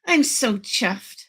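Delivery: normal talking voice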